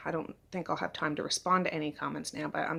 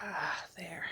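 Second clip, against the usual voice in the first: normal talking voice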